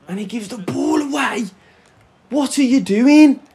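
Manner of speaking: real frustrated outburst; exasperated shout. Real, not acted. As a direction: yell at the screen as the ball is given away. Shouting at his player's mistake.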